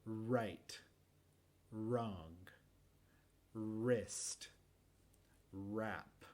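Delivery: "wrong" and "wrist" starting on a plain R, silent W; careful "wrap", W silent, R untrilled; American R. Shown as a demonstration. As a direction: model each word alone with a sonorous R. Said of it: "es el mismo sonido normalmente".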